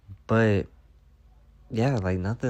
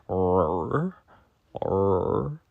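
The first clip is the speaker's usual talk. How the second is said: tummy roar; 2 semitones lower than usual. Retold or imitated. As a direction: growl like a hungry belly. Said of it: "roars at me".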